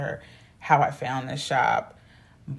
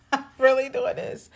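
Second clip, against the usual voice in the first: higher and louder, half laughing; squealed disbelief; giddy disbelief; 9 semitones higher, 5 dB louder than usual. Retold or imitated. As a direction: say it again, thrilled at yourself.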